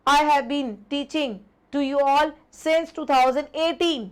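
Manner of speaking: each word laid out very slowly; deliberate teacher dictation pace; slow example read. Shown as a demonstration. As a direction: read the model sentence slowly for the class. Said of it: "teacher reads example sentence".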